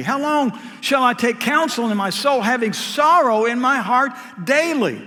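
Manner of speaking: preaching the psalm with rising force; fervent scripture reading. Acted. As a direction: cry the lament out to the congregation. Reads Psalm thirteen aloud.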